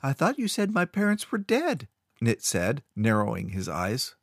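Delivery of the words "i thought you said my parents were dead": put-on much higher, wary child; suspicious boy. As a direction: question him warily.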